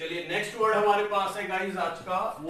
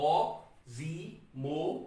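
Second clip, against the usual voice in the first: slow 'oxymoron' in four separated syllables; syllable split; much slower than usual. Shown as a demonstration. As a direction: stop for breath between the parts of 'oxymoron'. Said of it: "चार बार सांस ले रहा हूं". ('Oxymoron' को चार बार रुककर धीरे बोलें)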